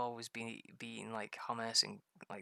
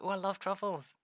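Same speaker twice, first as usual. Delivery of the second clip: higher, louder mock delight; imitated gushing; 8 semitones higher, 5 dB louder than usual. Husband imitating wife receiving wine, gushing. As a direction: gush like a delighted wife, put on. Retelling wife's delight at gifts.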